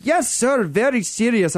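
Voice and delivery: put-on Indian scammer accent. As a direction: insist politely in an Indian accent. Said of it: "with a strange Indian accent".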